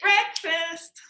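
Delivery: BREAKFAST called high, first syllable stressed; calling the dog. Shown as a demonstration. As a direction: call BREAKFAST with the weight on BREAK. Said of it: "How would you say it?".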